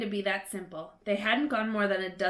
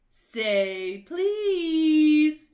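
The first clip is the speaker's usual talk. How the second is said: loud, higher, drawn-out taunt; teasing Peeves; 7 semitones higher, 8 dB louder than usual. A narrator's reading. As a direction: make Filch beg.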